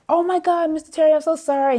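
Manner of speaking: very high, gushing mock mortification; retold panicked apology; flustered apology.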